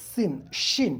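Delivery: শিন with air escaping, সিন sharp; contrasting সিন and শিন; sin and shin. Shown as a demonstration. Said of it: "শিনটা বাতাস বেরোবে".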